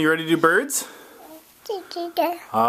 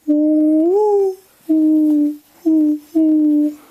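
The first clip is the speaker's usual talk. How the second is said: high, louder cooing ooh sounds; playful dove coo for the child; mourning dove coos; 13 semitones higher, 5 dB louder, slower than usual. Shown as a demonstration. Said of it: "I'll do the sound".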